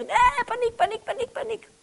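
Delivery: high, frantic repeated panic; hammed-up panic from a driver stuck in the car; mock panic. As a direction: squeal the word over and over like a frightened victim. Victim panicking in the wreck.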